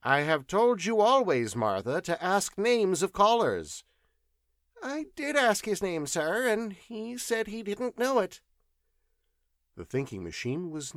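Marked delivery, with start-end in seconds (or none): 0.0-3.8 put-on higher, louder scolding old scholar; irritated professor. 4.8-8.4 put-on high, fast timid old servant voice; servant Martha. Servant answers professor.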